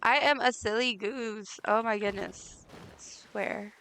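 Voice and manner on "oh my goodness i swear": ordinary talk, nothing marked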